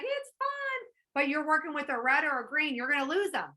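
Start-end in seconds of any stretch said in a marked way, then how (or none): 0.0-0.9 high, chirpy sing-song; bubbly blue. Retold or imitated. An always-bubbly blue brushing things off, high and chirpy. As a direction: bounce it like a carefree friend. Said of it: "if you're always like".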